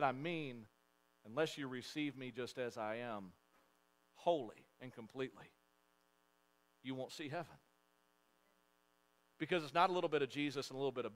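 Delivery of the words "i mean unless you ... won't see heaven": softer, lower, gentle plea; tender explanation. Acted. Jesus explaining himself to the crowd, softly and tenderly. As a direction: lay it down softly, sincerely.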